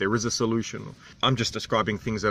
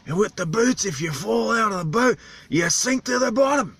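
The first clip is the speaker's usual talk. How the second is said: higher, strained local voice; islander's animated voice; 9 semitones higher than usual. Retold or imitated. Vlogger imitates islander ranting about vests and boots, strained high. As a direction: push the voice up like the animated old islander. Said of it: "it really hurts to talk like that".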